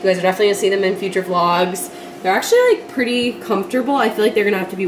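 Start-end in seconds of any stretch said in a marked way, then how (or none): 2.2-2.7 excited jump up. Real, not acted. Trying the shoes on.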